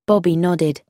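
ordinary delivery, nothing marked

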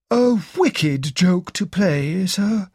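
slow, shaken butler; shocked. A narrator's reading. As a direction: let the shock slow the words. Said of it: "Parker said in a shocked voice".

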